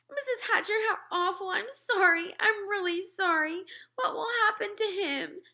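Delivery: put-on high, tearful little girl; a girl near tears apologizing to the mother; sniffling girl. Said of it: "Sheila cried".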